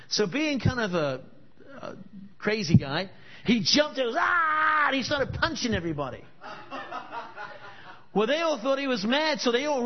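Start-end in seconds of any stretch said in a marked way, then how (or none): 4.2-4.9 short mock war cry; a sudden hammed-up shout; wild yell. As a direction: let out a crazy man's yell. Crazy guy jumps, yells.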